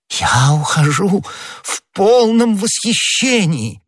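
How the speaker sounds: high, slow, fawning rapture; восхищённый Бонасье. Acted. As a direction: gush with servile delight. Figure of Bonacieux, delighted. (высокий, протяжный подобострастный восторг)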